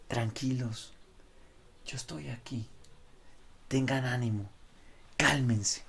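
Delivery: a hushed, tender voice of reassurance; soft reassuring Jesus. Retold or imitated. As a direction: soothe them like a calm shepherd. Preacher voices Jesus calming them.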